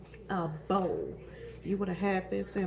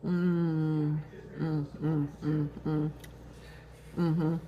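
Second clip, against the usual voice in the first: savoring hums; 2 semitones lower, 3 dB louder, much slower than usual. Real, not acted. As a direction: hum with pleasure at the taste. Tasting the dessert.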